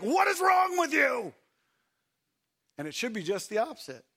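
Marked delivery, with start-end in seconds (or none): 0.0-1.3 mock outraged yell, higher and louder; voicing the annoyed onlooker with a loud high shout; exasperated shout. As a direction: yell it like a fed-up bystander. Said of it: "and they're like".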